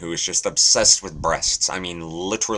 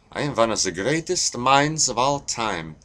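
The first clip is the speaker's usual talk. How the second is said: put-on higher-pitched, rough German accent; 6 semitones higher than usual. Joke teller voicing Albert Einstein, German accent. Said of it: "I need to work on my German accent".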